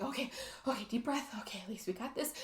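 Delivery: hushed, acted self-pep-talk; steady yourself under your breath; calming herself down. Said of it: "trying to calm herself down".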